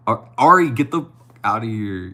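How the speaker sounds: loud, high yell of delight at a friend; excited shout. Real, not acted. Streamer spots best friend Ari in chat, delighted shout. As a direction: shout at a friend who just showed up.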